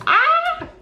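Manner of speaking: high squeal of delight; excited squeal. Real, not acted. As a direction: squeal like a dream came true. Thrilled about PR list.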